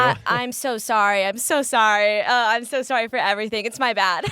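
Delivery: loud, hurried, gushing apology; over-apologetic Midwest nice. Shown as a demonstration. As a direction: pile on the sorries, Midwest style. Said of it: "Do you apologize a lot, too?".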